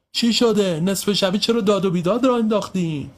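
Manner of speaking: high-pitched grumbling question; annoyed man wanting to know what's up; irritated demand. Acted. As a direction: complain at being woken by shouting. Man woken complains about the racket.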